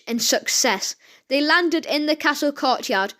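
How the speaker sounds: usual speaking voice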